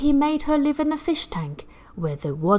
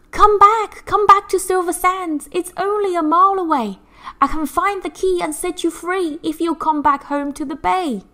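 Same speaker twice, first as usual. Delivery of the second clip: much higher, loud seagull voice; calling seagull; 10 semitones higher, 5 dB louder than usual. Acted. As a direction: urge her home like an eager bird. Seagull calls mermaid home.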